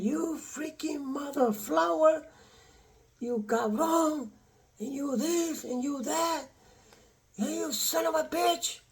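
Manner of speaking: high, hushed mocking voice of his cursing ex-wife; mimicking the ex-wife. Man mimics his ex-wife cursing him, high hushed mock voice. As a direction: squeeze the insults out high and soft like a shrill caricature. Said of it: "she started saying".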